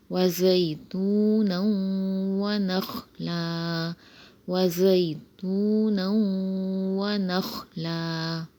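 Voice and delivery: slow, drawn-out tajweed recitation; slow Quran recitation. Shown as a demonstration. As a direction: recite the ayah slowly with stretched vowels. Recites the next ayah.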